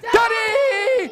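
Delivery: put-on high, drawn-out children's yell; shouted out. Children in the tree calling Stan, high long shout. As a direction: squeal it, holding the last vowel. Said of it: "They shouted out".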